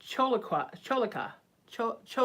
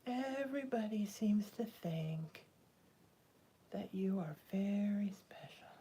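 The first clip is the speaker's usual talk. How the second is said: slow, soft whisper to her pet; whispering to a cat; 7 dB quieter, slower than usual. Real, not acted. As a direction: breathe it to the cat, slowly. Told to her cat twice.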